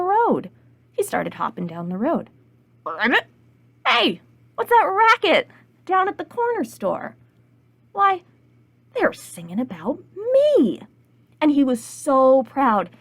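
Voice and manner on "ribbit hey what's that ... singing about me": croak, then high excited exclamation; croak, call out, swell with pride; bullfrog voice. Acted. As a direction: frog perking up with self-importance. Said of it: "There was the bullfrog".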